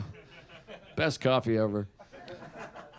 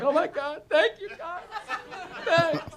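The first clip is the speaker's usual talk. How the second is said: high, lightly weepy thanks; mock tearful; 8 semitones higher, 4 dB quieter than usual. The lady started crying as people clapped, mock tearful voice. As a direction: imitate an overwhelmed bride giving thanks. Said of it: "Then the lady started crying".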